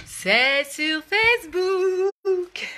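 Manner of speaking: loud, high, very drawn-out call; mock town-crier shout with long vowels; playful calling out. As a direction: holler it slowly to people searching, stretching each word. Calls out to people searching.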